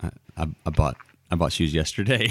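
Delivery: low, stammering sheepish admission; husband caught out, sheepish and low; sheepish confession. Retold or imitated. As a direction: mumble it like a guilty husband. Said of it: "And I was like".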